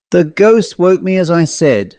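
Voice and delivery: ordinary delivery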